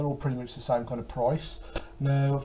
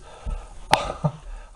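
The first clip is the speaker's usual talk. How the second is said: breathy, unvoiced laugh; real incredulous chuckle; disbelieving laugh. Real, not acted. Finds game prices stupid.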